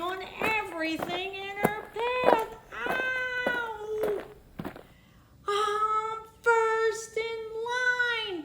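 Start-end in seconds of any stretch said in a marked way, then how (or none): 2.9-8.4 high, slow sing-song gloat; blurted brag. Acted. Beth Ann gloating at the front of the line. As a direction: crow it like the spoiled winner. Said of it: "blurted Blubbering Beth Ann".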